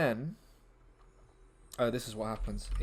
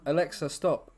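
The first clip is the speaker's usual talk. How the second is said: loud, raised command to the speaker; command to Alexa; 4 semitones higher, 7 dB louder than usual. Real, not acted. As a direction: call out firmly to stop it. Calling to smart speaker.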